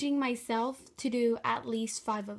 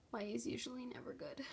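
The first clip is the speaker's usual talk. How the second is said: whispering; 10 dB quieter than usual. Real, not acted.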